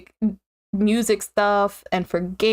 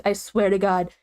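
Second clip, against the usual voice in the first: normal talking voice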